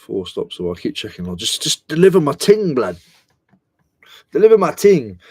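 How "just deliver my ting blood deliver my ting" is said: loud London roadman voice; hammed-up roadman, shouting it out; playful roadman impression; mock roadman. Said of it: "I always go to Roadman".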